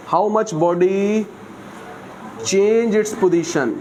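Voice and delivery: each word spaced out very slowly for students to write; slow dictation. Shown as a demonstration. Teacher dictating the definition to his class, very slowly.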